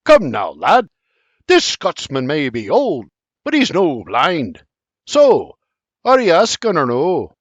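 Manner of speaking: a hint of the old Scot, knowing and teasing; lightly voiced teasing Scot. A narrator's reading. Old Scot teasing William about proposing, lightly voiced. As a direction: nudge the lad with a sly Scottish push. Sir John teases William.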